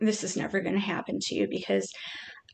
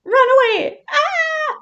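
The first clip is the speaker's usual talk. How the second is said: much higher and louder, a squealing mock panic; panicked turtle; 6 dB louder than usual. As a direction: voice the pet fleeing in cartoon terror. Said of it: "You're like".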